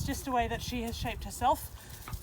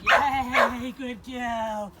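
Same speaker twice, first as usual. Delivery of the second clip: cheer; 11 dB louder, much slower than usual. Real, not acted. Dog finishes stand.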